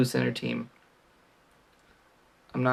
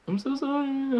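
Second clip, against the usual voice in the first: much higher squeaky sorry; squeaky apology; 14 semitones higher than usual. Pretend. Mock apology to viewers.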